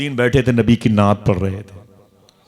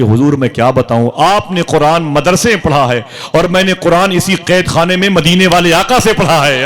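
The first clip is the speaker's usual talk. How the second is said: loud answer, building higher to a ringing climax; impassioned declaration; 6 semitones higher, 6 dB louder than usual. Acted. Prisoner tells the scholar who taught him the Quran, impassioned declaration. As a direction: proclaim it with mounting devotional fervor.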